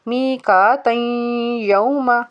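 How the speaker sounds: मी का तई यौमा run together with a nasal hum into यौमा; joined recitation. Shown as a demonstration. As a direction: link तई to यौमा without stopping. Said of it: "अगर हम इसको मिला के पढ़ेंगे".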